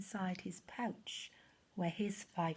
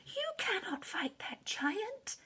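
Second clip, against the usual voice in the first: frightened brothers; 11 semitones higher, 5 dB quieter than usual. Acted. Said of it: "his frightened brothers cried".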